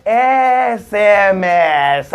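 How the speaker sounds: very slow SMS with the opening ए drawn out; teacher stretching the word for the class; slow motion. Shown as a demonstration. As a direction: hold the ए at the start of SMS. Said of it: "अब देखिए ए".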